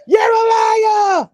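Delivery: slow high shouted accusation; angry shout. Pretend. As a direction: yell it out slowly, high. Judge shouts at Martell.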